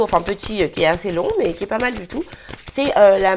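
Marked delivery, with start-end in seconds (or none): none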